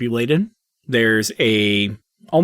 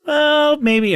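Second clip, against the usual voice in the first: high, cheerful little gnome voice, trailing off; a chatty little gnome remembering his life; gnome spirit. Acted. Gnome spirit chatting to Hank at the gate, high and cheerful. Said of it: "The gnome looks up and is like".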